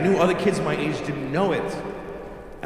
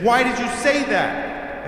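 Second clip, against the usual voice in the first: slow, high-pitched reproach; mock indignant questioning, drawn out; imitated furious mother; 7 semitones higher, slower than usual. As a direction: scold like an appalled mom. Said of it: "she's furious".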